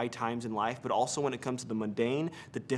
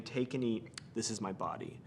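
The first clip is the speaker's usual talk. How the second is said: hushed Jesus words; 3 semitones lower, 10 dB quieter than usual. Acted. Jesus' words at last supper.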